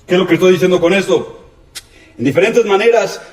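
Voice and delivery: usual speaking voice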